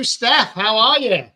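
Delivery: normal talking voice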